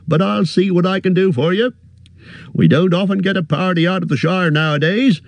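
a fussy, friendly innkeeper bending down; cheery innkeeper. A narrator's reading. Innkeeper greets the hobbit, high and bustling.

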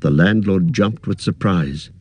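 usual speaking voice

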